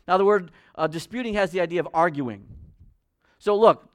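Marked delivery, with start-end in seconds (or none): none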